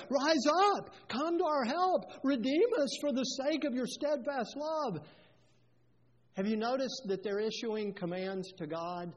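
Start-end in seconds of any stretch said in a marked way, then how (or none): 0.1-5.1 loud, higher pleading cry to God; urgent plea. Retold or imitated. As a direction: beg God to rise up, voice raised. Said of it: "So they ask the Lord".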